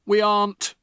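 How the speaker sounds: loud, flat-out refusal; blunt father. Acted. Father to Matilda, loud and blunt. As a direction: shut the door on her. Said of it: "the father said".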